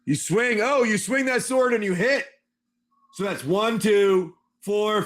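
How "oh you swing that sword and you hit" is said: normal talking voice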